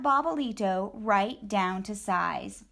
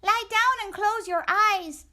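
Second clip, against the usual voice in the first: much higher, louder bossy chihuahua; high squeaky order; 12 semitones higher, 5 dB louder than usual. Acted. Poquito Tito ordering Scapito down, squeaky command. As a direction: boss him onto the tortilla. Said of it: "ordered Poquito Tito".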